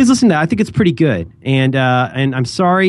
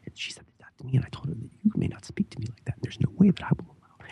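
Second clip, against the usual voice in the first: imitated hushed, indignant confiding; very articulate whisper; 12 dB quieter than usual. Imitating a woman confiding to a book in the library, precise whisper. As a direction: whisper each word precisely, as if confiding in someone. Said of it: "in a very, very, like, articulate voice".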